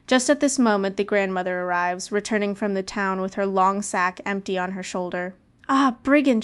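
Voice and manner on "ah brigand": loud, high outcry at the wolf; a loud angry call; cry out. Acted. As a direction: grandmother shouting at a brigand. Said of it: "she cries".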